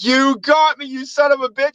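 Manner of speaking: high-pitched, hammed-up reaction; retold exclamation, pitched well up; mock outrage at prank.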